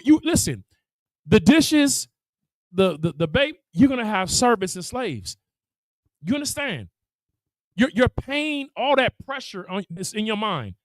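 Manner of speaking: usual speaking voice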